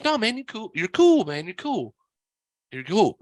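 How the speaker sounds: high-pitched imitation of a cool coworker, easing down. Coworker reassuring the apologetic storyteller, imitated in a high voice. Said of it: "can't help but try to talk like [name]".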